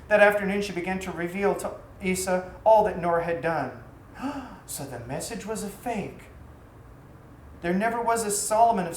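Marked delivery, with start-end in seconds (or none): 4.2-4.6 sharp, soft intake of breath; a quick gasp of sudden realisation; shocked gasp. A narrator's reading. Master learning he was betrayed, with a soft shocked gasp.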